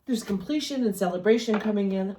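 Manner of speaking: words stretched by a yawn. Real, not acted. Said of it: "I don't know why I'm yawning".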